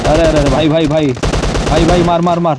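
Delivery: panicking gamer. Real, not acted. Sudden enemy fight in game.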